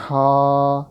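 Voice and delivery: ہا held long and loud from the throat; drawn-out letter name. Shown as a demonstration. Teacher spells for students, long loud ہا. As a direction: stretch ہا as in spelling. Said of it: "حلق کے درمیان سے ہی پڑھنا ہے".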